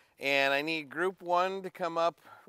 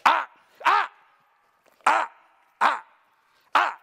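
high, loud, clipped warning sounds at the dogs; sharp "ah ah" correction; 9 semitones higher, 5 dB louder than usual. Real, not acted. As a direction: stop the dog with sharp high barks of "ah".

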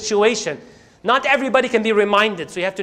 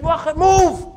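high, forceful angry order; angry command; 6 semitones higher than usual. Acted. Musa so angry separating two fighters, angry command. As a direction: throw the word out high and angrily. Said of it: "Musa was so angry".

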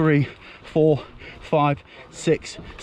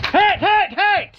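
high, loud shouted call; shouted hit call; 4 dB louder, much slower than usual. Real, not acted. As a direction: yell it out as you're hit. Calling out being hit.

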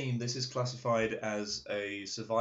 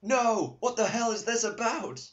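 mock horrified shout; 12 semitones higher, 7 dB louder than usual. Mock horror at photos.

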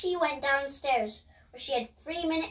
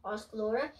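ordinary delivery

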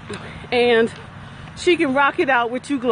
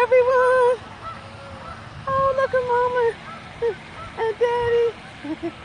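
cooing at ducks; 7 semitones higher than usual. Real, not acted. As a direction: coo at them like at little babies.

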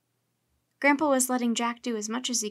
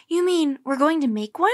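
loud, higher question ending on a rise; amazed, hardly believing it; astonished; 4 semitones higher, 8 dB louder than usual. A narrator's reading. Boy hearing they'll build a go-kart, astonished. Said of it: "with his mouth open".

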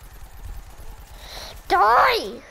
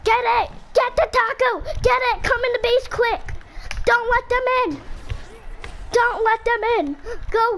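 pitched-up urgent shouts at teammates; excited high calling out; 6 semitones higher than usual. Real, not acted. Kid urging teammates to guard the base, excited high calls. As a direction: urge the team on, high and eager.

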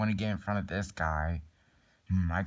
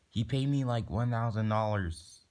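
normal talking voice

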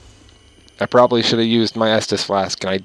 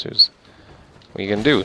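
ordinary talk, nothing marked

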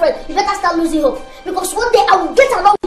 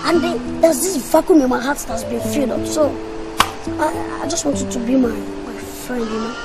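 soft wooing; 6 semitones lower, 8 dB quieter than usual. Acted. Wooing brother's girlfriend.

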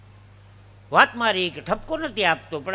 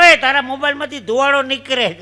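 raised voice, a little higher; calling out; 4 semitones higher, 6 dB louder than usual. Acted. Man warns another his phone is smoking, calling out. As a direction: call out a startled warning.

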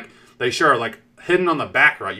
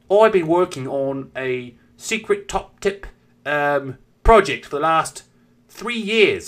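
hammed-up slow British boffin; higher, drawn-out put-on British accent; mock British accent; 4 semitones higher, 4 dB louder, slower than usual. Chocolate scientist announcing his project, mock British accent. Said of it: "The British accent".

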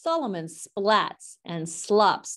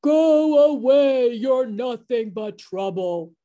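roar; 4 semitones higher, 4 dB louder than usual. A narrator's reading. Hippo roaring at Solomon to leave. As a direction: bellow it out, stretching the first words. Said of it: "roars the biggest hippo".

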